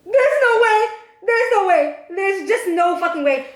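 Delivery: can't believe what she just heard; incredulous. Retold or imitated. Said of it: "I looked at her like".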